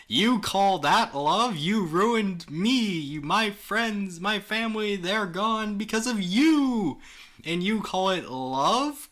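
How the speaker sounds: high, loud, slow accusation; angry. Acted. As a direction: hurl the blame at her, furious. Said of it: "getting angrier by the second".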